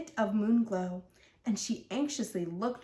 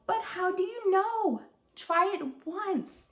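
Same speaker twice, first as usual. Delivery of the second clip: imploring; 8 semitones higher than usual. Acted. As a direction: beg her gently, stretching the words. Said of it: "he'd implore".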